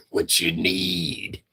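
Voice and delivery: slow, drawn-out eager offer; mock eager caller. Mocking men who call her, slow eager offer. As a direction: stretch each word like a simp offering money.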